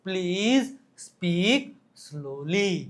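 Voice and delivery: word by word with long pauses; slow demonstration. Shown as a demonstration. Slowly demonstrates "speak slowly. (એક એક શબ્દ ધીમેથી)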